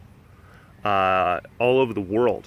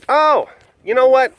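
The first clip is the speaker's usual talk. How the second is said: sudden idea; 12 semitones higher, 7 dB louder than usual. Real, not acted. Sudden change of mind.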